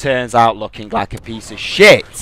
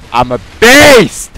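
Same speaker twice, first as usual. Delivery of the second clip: higher, louder, slow self-hype; loud boast; 8 semitones higher, 9 dB louder, slower than usual. Real, not acted. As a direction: shout your brag. Boasting at fanboy.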